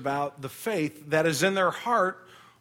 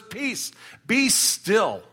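firm command; 5 semitones higher, much slower than usual. Acted. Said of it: "he says".

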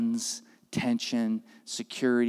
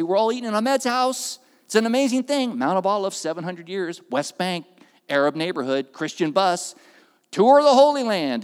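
loud, much higher tour-guide proclamation; mic announcement; 6 semitones higher, 8 dB louder than usual. Retold or imitated. Pastor announces the dinner over the bus mic, loud.

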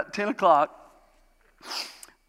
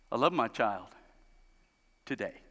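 weary admission; 6 semitones lower, 4 dB quieter than usual. Retold or imitated. Honest parent, weary confession.

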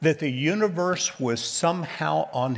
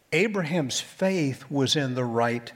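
ordinary talk

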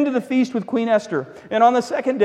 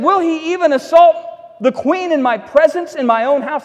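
higher, louder indignant cry; outraged king; 6 semitones higher, 3 dB louder than usual. Acted. King catching Haman at the queen's couch, outraged. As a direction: bellow it in disbelief.

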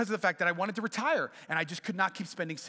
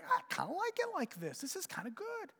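pleasantly surprised; 12 semitones higher, 5 dB quieter than usual. Retold or imitated. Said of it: "And I was like".

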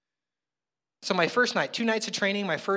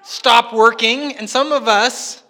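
very loud, drawn-out, higher-pitched declaration; emphatic preaching; 7 semitones higher, 12 dB louder, much slower than usual. Real, not acted. Preacher to congregation about work habits, loud emphatic preaching. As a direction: boom it out slowly, word by word.

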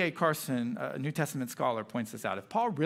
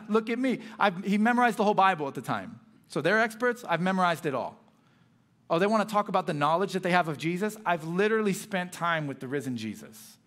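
louder, higher, pressing each boast home; Paul firmly answering the super apostles, raised and insistent; 3 semitones higher, 4 dB louder than usual. Retold or imitated. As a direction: channel Paul standing his ground, firm and fired up. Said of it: "he gets pretty firm here".

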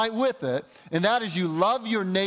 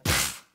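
short scornful horse-like snort. Shown as a demonstration. Preacher imitating a horse snorting at her, scornful snort. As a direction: blow out a dismissive snort. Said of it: "used to describe a horse snorting".

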